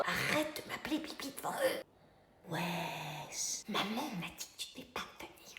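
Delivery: hushed, slow embarrassed whisper. Acted. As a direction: hiss it so guests won't hear.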